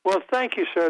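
ordinary talk

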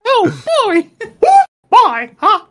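high-pitched cartoon mouse voice; Mickey Mouse. Messing with brother in the Disneyland line, cartoon mouse impression. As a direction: squeak it out like a cheery cartoon mascot. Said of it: "Mickey Mouse impression".

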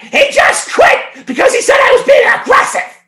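very high, loud mock wail; imitating screaming lady. Lady complaining to Joe about the quitting worker, shrieking mock outrage. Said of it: "And she goes".

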